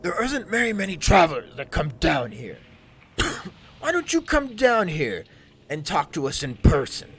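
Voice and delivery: loud, high, strange bugbear beckoning. Acted. Bugbear calls Skullface down to talk in person. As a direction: lure them down with a loud creature call. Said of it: "the bugbear looks to you and he goes".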